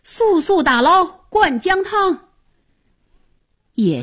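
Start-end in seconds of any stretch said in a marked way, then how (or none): 0.0-2.3 raised-pitch official's order; urgent command. A narrator's reading. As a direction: order the whole crew to act at once. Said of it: "林大人吩咐众人".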